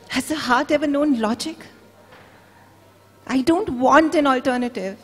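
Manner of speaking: mother defying her husband's logic; passionate defiance. Acted. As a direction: refuse him with a mother's fierce love. Wife's defiant outburst.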